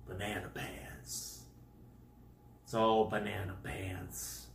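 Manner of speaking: low, near-whispered aside; hushed disbelief. Real, not acted. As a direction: say it under the breath, low and soft. Muttering at the absurdity.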